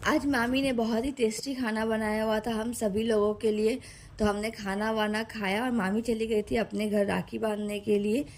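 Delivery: ordinary talk, nothing marked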